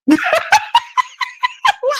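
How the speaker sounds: real high-pitched burst of laughter. Real, not acted. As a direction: crack up laughing. Laughs at own microphone joke.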